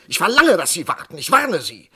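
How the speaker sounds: a desperate doctor yelling at the warden; loud demand. Acted. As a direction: demand it at full voice, then warn. Doctor demands delay of execution.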